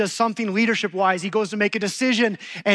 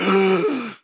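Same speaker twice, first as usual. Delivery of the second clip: panicked gasp; 4 dB louder than usual. Retold or imitated. Husband panics at wife's question.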